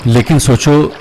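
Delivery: slow, projected meditation voice with long pauses; guided meditation. Acted.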